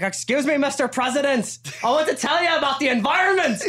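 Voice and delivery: high, scolding rock-star impression with a Belfast lilt. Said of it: "[name]'s imitation of [name] scolding the crowd".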